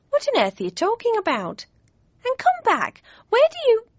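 a fluttering mother calling after her daughter; fussy mother. Acted. Mother talking, rising alarm.

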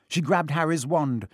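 ordinary delivery, nothing marked

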